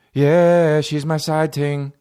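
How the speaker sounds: imitating a slow Jamaican drawl; slow, joking Jamaican impression; mock Jamaican accent. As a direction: draw it out in a put-on Jamaican accent. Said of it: "like, with a Jamaican accent".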